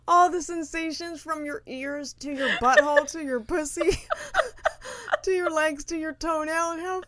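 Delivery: high, breathless laughter breaking through every word; can barely get it out for laughing. Real, not acted. Laughing at her friend's float tank misery, giggling helplessly. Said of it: "I am laughing hard at your misery".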